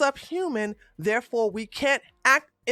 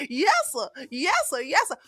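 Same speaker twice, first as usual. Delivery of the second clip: mock obedient yes sir; 4 semitones higher than usual. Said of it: "the way Massa wants me to think".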